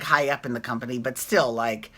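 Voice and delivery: normal talking voice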